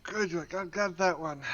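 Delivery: normal talking voice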